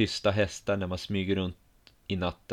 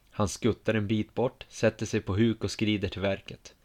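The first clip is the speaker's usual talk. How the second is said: ordinary delivery, nothing marked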